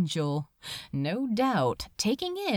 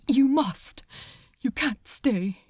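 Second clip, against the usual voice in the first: a note higher, tight with grief; strained, higher voice; 3 semitones higher than usual. Acted. The angel sending Crowley away, strained and higher. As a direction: keep the pitch up and fragile. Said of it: "his voice was a note higher".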